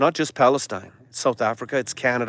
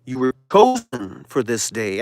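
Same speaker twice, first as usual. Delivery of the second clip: divine reassurance; 6 semitones lower than usual. Acted. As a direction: speak as God comforting his chosen. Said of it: "Your Adonai Elohim says to you".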